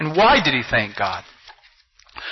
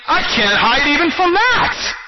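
high-pitched, cornered cry; preacher voicing a cornered Jonah, high exasperated cry; Jonah's exasperated outcry; 10 semitones higher than usual. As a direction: cry out as if there is nowhere left to hide.

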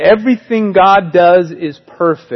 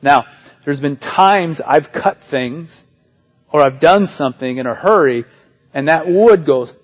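ordinary talk

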